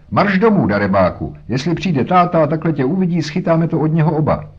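high, loud motherly telling-off; scolding shout. A narrator's reading. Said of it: "křičela". (hubující křik na dítě)

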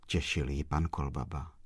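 ordinary delivery

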